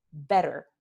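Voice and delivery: better with a quick tapped t; American flap in better. Shown as a demonstration. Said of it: "like in the word".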